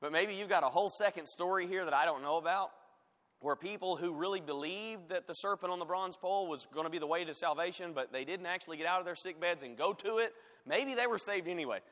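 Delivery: sarcastic. Real, not acted. Preacher supposing a whole second story, sarcastic. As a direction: float a mock theory in earnest-sounding sarcasm. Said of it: "I'm being sarcastic".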